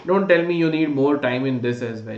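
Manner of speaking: ordinary talk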